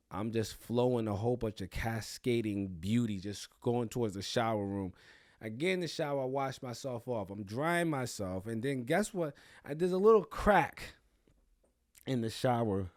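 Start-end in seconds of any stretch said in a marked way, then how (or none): none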